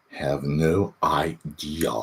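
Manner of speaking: slow, drawn, trailing repeat. Shown as a demonstration. Streamer repeating to chat, slowed down. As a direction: let it drag out slowly. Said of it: "repeated slowed".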